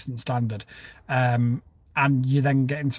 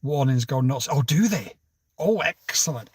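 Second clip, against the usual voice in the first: ordinary talk, nothing marked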